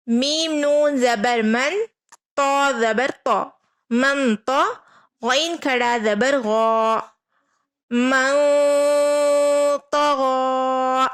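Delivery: slow recitation, hold the nasal in من تغا; slow Quran spelling. Shown as a demonstration. Teacher spells out Quran letters for students slowly. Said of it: "اس طرح".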